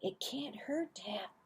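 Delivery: whispered sneaky plea; hushed tempting whisper. Acted. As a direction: whisper it guiltily. Boys sneaking more pudding.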